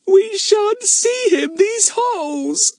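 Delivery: put-on very high, slow, wailing child's lament; wailing little girl. A narrator's reading. Betts wails that she won't see Fatty, slow and high. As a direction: wail it like a disappointed small girl. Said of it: "suddenly wailed Betts".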